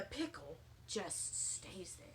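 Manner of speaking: hushed, eerie whisper; spooky hushed aside. A narrator's reading. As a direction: whisper the odd detail. Sheldon's weird whispered detail.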